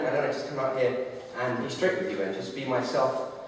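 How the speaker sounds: ordinary delivery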